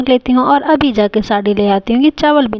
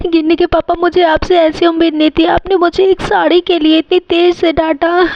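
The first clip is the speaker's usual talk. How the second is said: higher-pitched wounded wail; acted tearful hurt; 6 semitones higher than usual. Wife hurt by husband's scolding, tearful wailing. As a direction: protest as if breaking into tears. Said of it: "mummy कितना ज़्यादा रो रही है".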